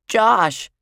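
put-on high, loud little-boy call; excited cry. A narrator's reading. Bradley greets his brother Josh, excited cry. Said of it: "cried Bradley".

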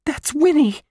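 very high, loud exclamation; excited cry. Acted. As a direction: call it out in sudden recognition. Girl recognises the missing one's cry.

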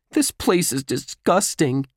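high-pitched disgusted grumble; complaining. A narrator's reading. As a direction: complain like a kid who hates it here. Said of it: "Josh complained".

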